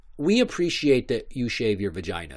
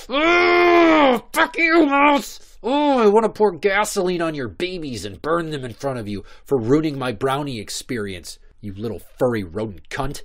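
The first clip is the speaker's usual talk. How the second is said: shrieking rage, much higher and louder; furious shriek; 5 semitones higher, 6 dB louder than usual. Retold or imitated. Cursing the mouse that ruined his brownie, shrieking rage. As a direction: scream it at the mouse in a frenzy. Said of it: "And I was just like".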